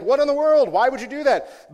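high, forceful mock outrage; indignant protest. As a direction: demand an explanation, loud and incredulous. Acting out his indignant email.

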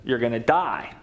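God pronouncing doom; deep voice of judgment. Acted. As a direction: drop into a grave divine voice. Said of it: "this voice says".

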